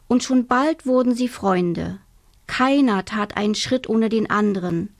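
plain reading voice

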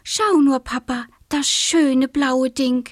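put-on high, enraptured little beetle girl; wide-eyed childlike enthusiasm, lingering on the words; gushing. A narrator's reading. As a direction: marvel with drawn-out delight. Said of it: "schwärmte Marie-Luise".

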